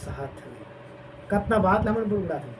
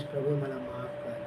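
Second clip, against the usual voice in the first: softer, pleading prayer; hushed plea; 5 semitones lower, 11 dB quieter than usual. Retold or imitated. Moses begging God for mercy, hushed. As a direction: beg quietly for mercy.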